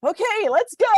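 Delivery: high, upbeat "let's do it"; eager mock reply. Wife acting out her answer to husband's surprise, eager. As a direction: pitch it up like jumping at the chance.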